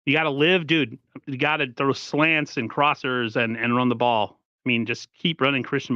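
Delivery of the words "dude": ordinary talk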